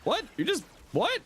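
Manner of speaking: high, baffled question; can't believe what happened; surprised. Real, not acted. Surprised at game.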